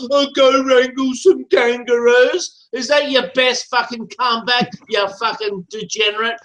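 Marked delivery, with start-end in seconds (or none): 0.1-2.7 slow sneering mimicry of his line; drawn-out taunting echo of an insult; mocking repeat. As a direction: parrot his comeback back with scorn. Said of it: "Is that, is that your best fucking comeback".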